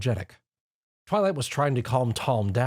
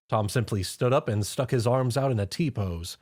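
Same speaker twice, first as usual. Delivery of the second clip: plain reading voice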